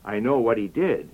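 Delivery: usual speaking voice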